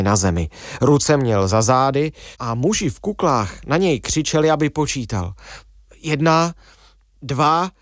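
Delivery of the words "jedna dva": halting count, raised pitch; higher, separated words, counting on command; obedient counting. A narrator's reading. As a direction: count one by one with clear gaps. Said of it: "snažil se splnit jejich pokyn".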